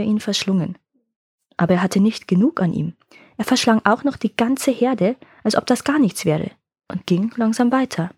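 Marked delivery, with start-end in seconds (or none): none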